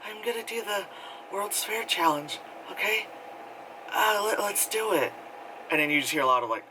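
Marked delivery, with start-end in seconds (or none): 0.0-5.1 eerie vlogger voice. Retold or imitated. Girl announcing her challenge to the camera in a hollow, high voice. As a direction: announce the challenge like a dazed influencer.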